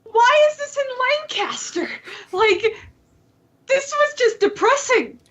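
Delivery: high, mock dismay; a hammed-up sad whine. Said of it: "And I was like".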